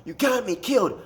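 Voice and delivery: high-pitched imitation of a raging gamer; mock outburst, voice jumping up; retold angry snap. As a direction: act out a buddy losing it. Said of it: "And he snaps".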